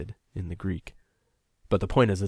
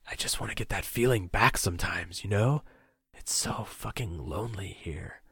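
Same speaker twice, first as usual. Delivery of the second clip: lonely whisper; 2 semitones higher than usual. Acted. Captor confesses to captive, lonely whisper. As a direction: confide the loneliness under your breath.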